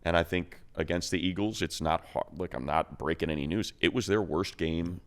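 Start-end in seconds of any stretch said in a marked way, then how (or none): none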